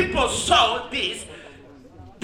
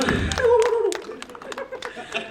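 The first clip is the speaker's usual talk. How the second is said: high laugh; 13 semitones higher than usual. Real, not acted. Thanking manager, bursts laughing.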